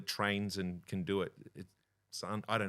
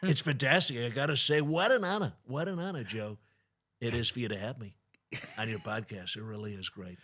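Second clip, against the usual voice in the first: Trump impression; 3 semitones higher, 4 dB louder than usual. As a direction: gush like Trump on stage. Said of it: "President Trump's been".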